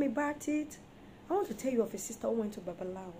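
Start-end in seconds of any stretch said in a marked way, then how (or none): 1.3-3.1 fast aside. Real, not acted. Starting a fast story aside.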